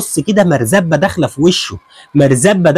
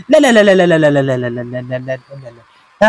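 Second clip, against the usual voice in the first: shocked fading no; 4 semitones lower than usual. Real, not acted.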